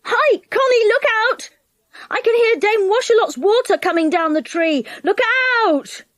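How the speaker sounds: high, loud call up the tree; yelled warning. A narrator's reading. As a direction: warn her urgently. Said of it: "and he yelled loudly".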